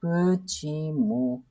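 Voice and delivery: slow spelling-out. Shown as a demonstration. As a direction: murmur Почему slowly as you write it. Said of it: "And how we are writing?".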